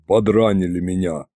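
low, tired dragon voice; sighing, weary. A narrator's reading. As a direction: break bad news with a sigh. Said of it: "вздохнул он".

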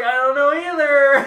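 high, loud, drawn-out mock wail; gleefully hammed-up protest; retold giddy cluelessness. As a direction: whoop it out, stretching the words. Retelling own gleeful clueless reply.

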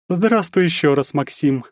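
high, languid seductive greeting. A narrator's reading. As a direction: draw it out, seductive. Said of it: "томным голосом произнесла Анфиса".

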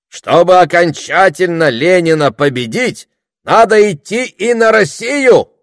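high, loud, rising to a cry; rally the crowd to march; shouted oratory. A narrator's reading. Orator urging the crowd toward Russia, shouting. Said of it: "выкрикнул оратор".

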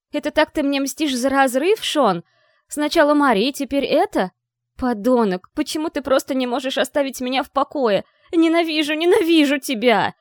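громкий крик со срывом голоса; кричи сквозь подступающие слёзы; breaking furious scream. A narrator's reading. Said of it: "Голос сорвался". (голос срывается от крика)

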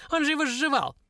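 high mocking dwarf voice; cackling dwarf. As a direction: sneer it like a gleeful little dwarf. (насмешливый голос карлика)

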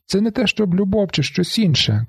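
high, shouted confession. A narrator's reading. Marla calling to the narrator, shouting. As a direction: call it across the roof. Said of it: "кричить Марла".

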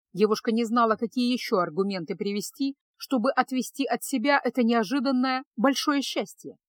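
ordinary delivery, nothing marked

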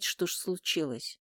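ordinary delivery

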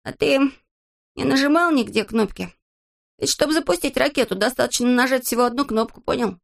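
high, pressing character voice; high-voiced storybook interrogator; suspicious Незнайка. Character interrogates his friend.

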